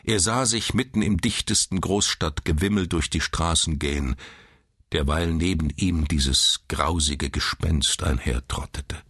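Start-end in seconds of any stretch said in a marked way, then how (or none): none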